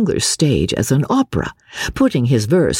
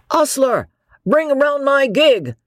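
calling out; 13 semitones higher than usual. Acted. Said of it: "cries another at the door".

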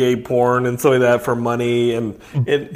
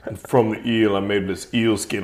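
low, soft, grave wrestler voice; Razorblade; 5 semitones lower, 4 dB quieter than usual. Acted. Wrestler explaining his costume in interview, low solemn voice. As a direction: tell the origin solemnly and low. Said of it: "Serious interview with Razorblade".

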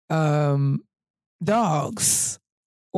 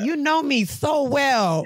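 slow, higher grateful reply; touched thanks; 6 semitones higher, slower than usual. Retold or imitated. Thanking LaToya, slow and pleased. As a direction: draw it out, touched. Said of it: "And I said".

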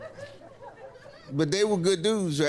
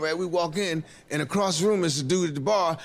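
normal talking voice

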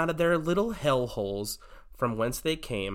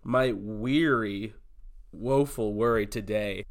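slow, drawn-out mock gravitas; host opening his woes segment, slow and grand; dramatic announcement; 3 semitones lower than usual. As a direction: drag out each word for effect.

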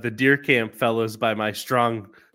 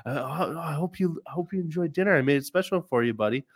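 higher, softened pet-owner voice; serving the dog; 5 semitones higher, faster than usual. Retold or imitated. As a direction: present dinner gently to a pet. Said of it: "I scoop it in and I go".

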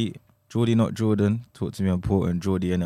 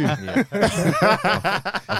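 slow words through laughter; laughing; 13 semitones higher, 4 dB louder than usual. Real, not acted. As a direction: giggle while talking. Laughing at himself.

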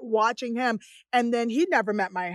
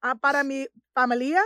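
put-on higher, careful American-accented Spanish; eager tourist attempting Spanish; halting learner's Spanish; 3 semitones higher, 4 dB louder, slower than usual. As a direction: try out your few Spanish words, haltingly. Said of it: "trying to use my Spanish".

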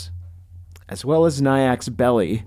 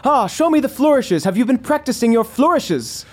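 hearty elven father; 11 semitones higher than usual. Acted. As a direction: greet your son with a jolly challenge. Ghost father speaks to son.

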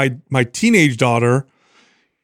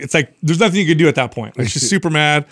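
ordinary talk, nothing marked

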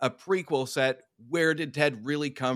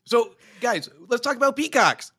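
put-on Boston accent; 6 semitones higher, 5 dB louder than usual. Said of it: "your Boston accent needs work there".

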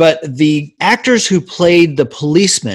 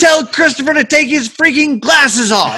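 mock director yell; 9 semitones higher than usual. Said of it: "they'd be like".

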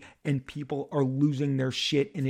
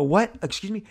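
higher, startled double question; surprised; 7 semitones higher than usual. Retold or imitated. To his buddy from Sony, startled disbelief. Retold disbelief at friend's news.

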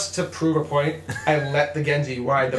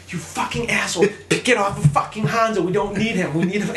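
higher-pitched mock gamer rant; retold anger; 4 semitones higher than usual. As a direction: imitate yourself fuming at a teammate. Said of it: "Why are you so angry?".

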